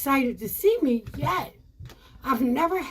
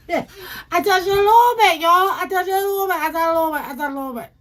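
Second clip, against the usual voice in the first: half-crying, higher and louder; mock sobbing; 4 semitones higher, 6 dB louder, faster than usual. Comic telling the crowd, mock crying. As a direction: wail it like a hurt kid. Crying retelling, cry heard.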